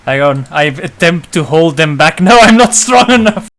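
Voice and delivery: high, loud, laughing yell of effort; laughing strained shout. Acted. Straining to hold party back.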